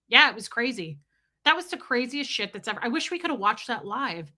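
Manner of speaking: ordinary delivery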